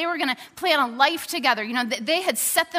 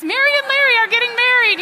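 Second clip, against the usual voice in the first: much higher, louder cheer; cheering crowd; 11 semitones higher, 9 dB louder, faster than usual. Retold or imitated. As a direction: call it out like the whole cabin celebrating. Plane cheers the engagement.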